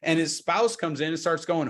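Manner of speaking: normal talking voice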